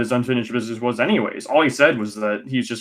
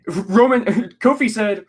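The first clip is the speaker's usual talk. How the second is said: laughing; 8 semitones higher than usual. Real, not acted. Laughing at the quote.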